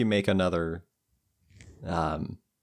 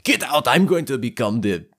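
loud, higher Arnold-style bellow; shouting in character; 4 semitones higher, 5 dB louder than usual. Acted. Dwarf orders everybody out of the room, shouting. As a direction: bellow like Schwarzenegger playing a Russian. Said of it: "then shouts at everybody".

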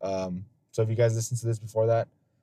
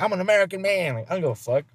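mocking macho American; 8 semitones higher than usual.

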